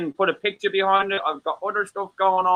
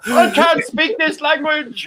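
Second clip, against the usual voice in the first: much higher, slow goofy voice; silly put-on voice; 11 semitones higher, much slower than usual. Man joking he can't speak the language, in a slow goofy voice. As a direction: stretch it out in a daft comic voice.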